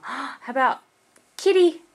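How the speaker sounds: gasp, then a high, loud Kitty; excited. Acted. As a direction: play up delight for the baby. Excited prompt to toddler.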